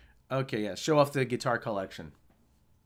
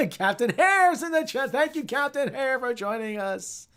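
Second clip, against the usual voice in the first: high, loud, laughing greeting; excited welcome; 10 semitones higher, 3 dB louder than usual. Real, not acted. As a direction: hail the guest with high-pitched excitement. Excited greeting of a chat arrival.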